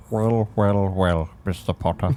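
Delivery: a lightly voiced sneering professor; Snape impression. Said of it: "It sounds like he's gargling".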